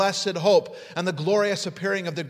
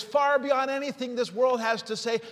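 ordinary talk, nothing marked

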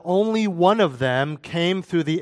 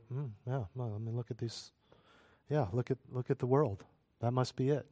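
soft, lower, dismissive murmur; mock shrug; 3 semitones lower, 12 dB quieter than usual. Preacher imitating society shrugging off scripture, dismissive. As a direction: lazily agree with a shrug in the voice.

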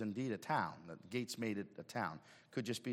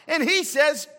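high, loud, impassioned sermon; full-force preacher's cry; fiery preaching; 14 semitones higher, 19 dB louder, much slower than usual. Acted. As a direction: preach it hard to the crowd.

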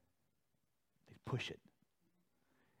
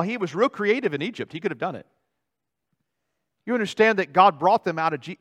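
usual speaking voice

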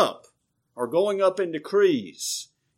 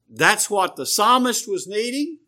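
ordinary talk, nothing marked

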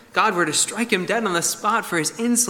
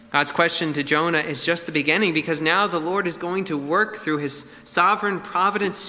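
usual speaking voice

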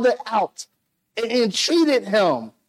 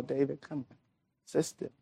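much softer, coaxing invitation; gentle coaxing; 6 semitones lower, 14 dB quieter than usual. Retold or imitated. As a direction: softly urge him to come in. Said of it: "gently encouraging his son".

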